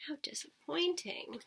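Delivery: soft, let-down whisper; disappointed whisper. Acted. Narrator sighing over the cancelled Mars trip, whispered. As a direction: breathe it out, deflated.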